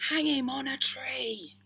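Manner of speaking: loud, slow, high angry shout; crowd shouting. A narrator's reading. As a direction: yell it like an angry mob. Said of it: "many in the crowd shouted".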